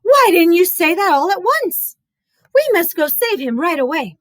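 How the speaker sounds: high, loud exasperated man; scold, then rush; exasperated painter. Acted.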